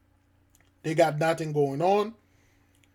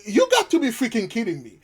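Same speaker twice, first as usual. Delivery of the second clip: higher, scoffing; exasperated disbelief; 6 semitones higher than usual. Real, not acted. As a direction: scoff at the news. Reacts to replayed news clip.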